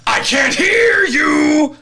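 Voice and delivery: high, slow shouting captain. Pretend. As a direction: bellow like the cartoon pirate captain. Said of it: "SpongeBob intro chant parody".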